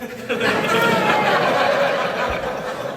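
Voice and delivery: a high burst of laughter; high cackle. Real, not acted. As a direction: cackle at your own punchline. Laughs at own joke.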